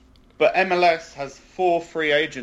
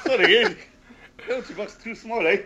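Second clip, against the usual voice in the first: high-pitched, bad Canadian accent; 7 semitones higher than usual. Podcast host joking about small penalty boxes, in a high mock-Canadian accent. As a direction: put on a comic Canadian lilt. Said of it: "That's a horrible Canadian accent".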